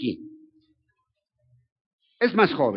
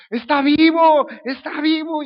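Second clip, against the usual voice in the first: a much higher, much louder cry; joyful shout; 11 semitones higher, 9 dB louder, slower than usual. Retold or imitated. Disciple running through Jerusalem shouting the news. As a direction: yell it through the streets at dawn. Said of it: "y está gritando".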